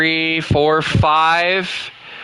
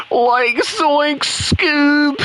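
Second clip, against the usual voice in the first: Shaggy; 8 semitones higher, much slower than usual. Shown as a demonstration. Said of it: "that was supposed to be Shaggy".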